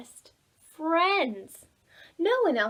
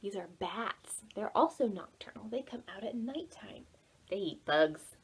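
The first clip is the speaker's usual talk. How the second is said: soft, unvoiced whisper; whispered aside; 4 semitones lower, 7 dB quieter than usual. A narrator's reading. Teacher telling kids about bats, whisper. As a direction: lean in and whisper a fact.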